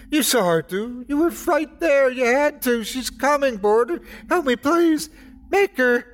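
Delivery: very high, panicked pleading; crazed screaming. A narrator's reading. Drunk attacker insisting she is coming, screaming for help. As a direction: beg frantically, voice pitched right up. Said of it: "screaming and swearing and crying".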